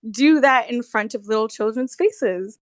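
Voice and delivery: ordinary delivery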